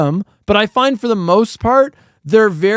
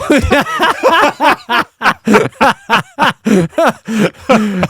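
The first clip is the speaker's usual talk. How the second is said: real laughing fit, higher pitched; long hearty laugh; 4 semitones higher than usual. Real, not acted. Co-host cracks up at being called soulless, long laugh. Laughs at joke about no soul.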